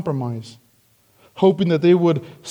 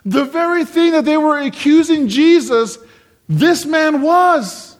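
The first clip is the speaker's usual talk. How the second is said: high, impassioned pulpit voice, stretching the last words; emphatic preaching; 7 semitones higher, 4 dB louder than usual. Real, not acted. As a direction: drive the irony home, pitched up. Preacher drives home the irony.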